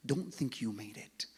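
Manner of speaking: normal talking voice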